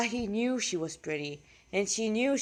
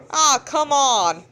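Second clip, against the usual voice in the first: louder, slower, drawn-out Oh, come on; exaggerated exasperation; 6 semitones higher, 8 dB louder, slower than usual. Real, not acted. Narrator redoing a line, louder and drawn out. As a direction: stretch it out, mock fed up.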